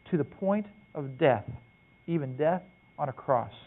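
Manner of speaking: solemn scripture read, slowing near the end. Acted. Preacher reading the scripture passage to the men, solemn. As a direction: read it out with gravity.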